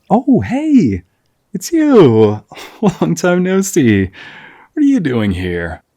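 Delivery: high, loud, delighted surprise at the door; surprised greeting. Acted. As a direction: greet an old friend who turned up out of nowhere.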